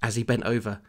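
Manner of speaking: ordinary talk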